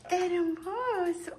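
breathy whispered coo; tender whispered hermoso. Real, not acted. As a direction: whisper it softly to the bird. Cooing to pet bird.

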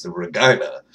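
"Regina" as ruh-JYE-nuh; Regina rhyming with vagina. Shown as a demonstration. Vlogger showing the city's pronunciation, "Regina" with a long "eye". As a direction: give "Regina" a long i in the middle. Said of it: "that's how you pronounce that city's name".

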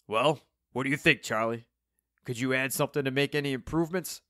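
lightly voiced old rocker, hopeful; Steve, eager old rocker. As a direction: ask it keenly in character.

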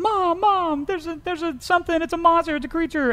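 put-on a frightened three-year-old running to Mom; scared little kid. Little boy runs inside crying to his mom, high and panicked. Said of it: "this time he's crying to Mom".

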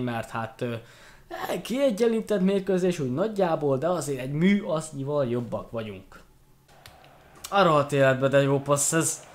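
ordinary talk

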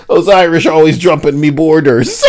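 high, hammed-up Irish accent; cartoon Irish, tickled by the bit; mock Irish accent. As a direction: do a silly high-pitched Irish voice. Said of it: "Listen to this Irish thing".

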